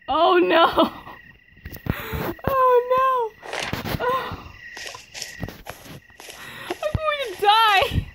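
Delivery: high, strained panic at a dead end; panicked. Real, not acted. Girl cornered in game shrieks in high panic. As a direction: squeal in fright, dragging it out.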